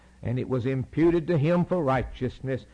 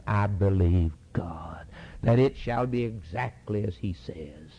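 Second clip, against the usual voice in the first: hushed confession; 6 semitones lower, 3 dB quieter than usual. Retold or imitated. Preacher quoting Paul in a whisper. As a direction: breathe the words out like a secret faith.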